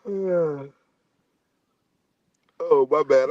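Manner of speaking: talking through a yawn; mid-yawn, words stretched. Real, not acted. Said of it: "I'm yawning and stuff".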